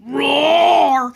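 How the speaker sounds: long drawn-out mock roar; pretend lion roar. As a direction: roar playfully to scare. Bob scares Becca with lion.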